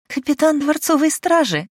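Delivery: high, loud laughing outburst; burst of laughter. Acted. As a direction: laugh it off, incredulous. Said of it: "разразилась смехом Деспина".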